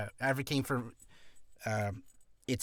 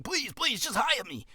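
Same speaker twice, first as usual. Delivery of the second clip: high-pitched, whiny mock plea; desperate begging; 9 semitones higher than usual. Voice actor begs to be hired, mock desperate pleading. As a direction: beg in an exaggerated high voice. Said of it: "don't come across as desperate".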